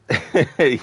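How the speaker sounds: quick amused laugh; a burst of laughter; short laugh. Real, not acted. Laughing at game voice line.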